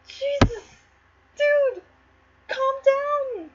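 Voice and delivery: very high, drawn-out aside; mock dismay. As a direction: squeal it at the ranting king. Reader's aside on the king's rant.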